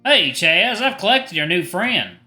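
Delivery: bright Southern call-out, high and loud; cheery Southern announcement to a husband; Southern accent. Acted. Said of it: "he has a southern accent".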